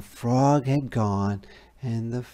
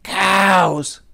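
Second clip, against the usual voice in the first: frog; 9 semitones higher, 8 dB louder than usual. A narrator's reading. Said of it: "said the frog".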